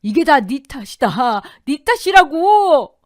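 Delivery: slow, high, drawn-out yell of blame; furious howl, rising to the last word; screaming in rage. A narrator's reading. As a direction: scream the blame with all your might. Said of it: "악을 쓰며 외쳤습니다".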